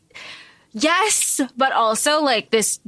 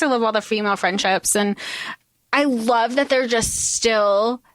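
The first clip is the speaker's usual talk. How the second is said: ordinary talk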